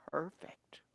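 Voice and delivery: whispered perfect. A narrator's reading. Narrator whispering how perfect the friends are. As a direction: breathe the word.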